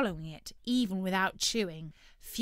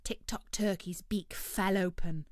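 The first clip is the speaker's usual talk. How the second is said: ordinary delivery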